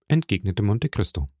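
usual speaking voice

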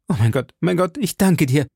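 high outpouring of thanks; relieved, high-pitched exclamation; fervent cry. A narrator's reading. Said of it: "rief der junge Mann".

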